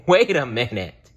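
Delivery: amused mother, chuckling as she talks; a mother laughing off an eager plan. Acted. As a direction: speak it through a light laugh. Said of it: "laughed Franklin's mother".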